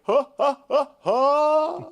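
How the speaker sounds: high, loud honking mock laugh; Jimmy Carr laugh. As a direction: imitate a comedian's odd laugh, far above your own voice. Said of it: "And he was like".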